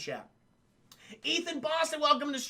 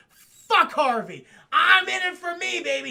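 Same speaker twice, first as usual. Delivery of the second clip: loud, slow, higher growling villain voice; Big Bad Harv snarl; 9 semitones higher, 11 dB louder, slower than usual. Big Bad Harv roars at the psychiatrist, a loud slow snarl. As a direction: bellow it like the unhinged alter ego. Alter ego snarls at the doctor.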